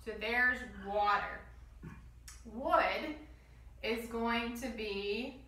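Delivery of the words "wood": normal talking voice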